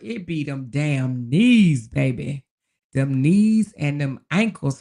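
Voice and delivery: drawn out slowly, stressed; slow it down for emphasis; slow emphatic. Real, not acted. Emphatic complaint about knees.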